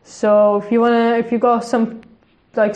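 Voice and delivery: ordinary talk, nothing marked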